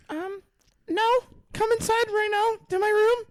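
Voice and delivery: high, loud granny voice; a grandma calling him in; foul mouthed granny. Acted. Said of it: "my grandma voice is not good".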